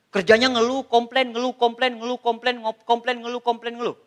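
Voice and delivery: monotone, looping drone of grumbles; mocking nonstop complaining. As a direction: chant it on one note like a stuck record.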